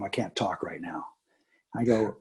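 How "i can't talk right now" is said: low, voiceless whisper of "I can't talk right now". Retold or imitated. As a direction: whisper so the table won't hear. Son mid D&D game.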